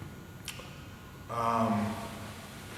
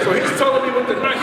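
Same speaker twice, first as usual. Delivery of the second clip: ordinary talk, nothing marked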